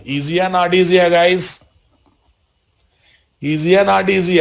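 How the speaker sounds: rally the students, upbeat; lively teacher question. Real, not acted. Teacher rallies class, louder.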